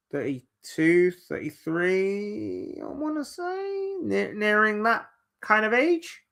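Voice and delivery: drawn-out, rising high as you guess; hesitant, high-pitched estimate; unsure guessing. Real, not acted. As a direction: stretch the words upward, tentatively. Guessing a player's age aloud.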